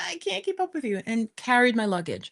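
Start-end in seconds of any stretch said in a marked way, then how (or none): 0.1-1.0 breathless plea. Retold or imitated. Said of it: "I'm like".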